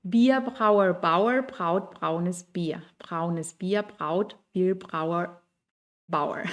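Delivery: Wir brauer Bauer read very slowly, each word placed; careful slow read of a German tongue twister. Shown as a demonstration. Teacher reads a German tongue twister aloud for the class, slowly. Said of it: "A ver, voy a leer".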